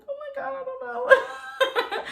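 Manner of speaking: squealed way up high with a laugh, slow and bashful; giggly schoolgirl. Acted. Waiting on the bed for him, giggling like a shy schoolgirl. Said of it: "kinda like the schoolgirl".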